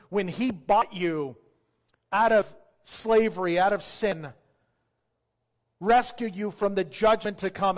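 high, loud, driving preacher's voice; impassioned preaching. Real, not acted. Preacher urging listeners to receive salvation by grace, impassioned. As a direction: press it home with forceful, punched phrases.

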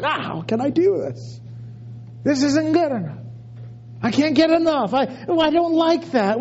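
high mock-whiny complaining; whining. Preacher mocking himself whining about his diet. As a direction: whine like a spoiled eater. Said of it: "And I'm crying and whining".

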